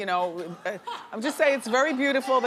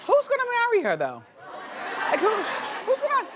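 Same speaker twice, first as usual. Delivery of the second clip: incredulous squeal; 12 semitones higher than usual. Real, not acted. Host marvels at the daughter's ring, high incredulous squeal. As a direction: shriek it in disbelief.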